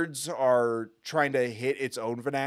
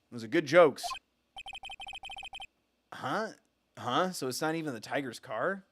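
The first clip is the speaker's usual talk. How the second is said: ordinary talk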